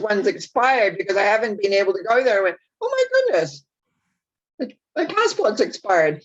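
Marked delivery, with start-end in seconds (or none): 2.8-6.2 high-pitched mock dismay, dropping at the end; surprised self-quote. Reliving finding an expired passport, surprised. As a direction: act out a startled discovery.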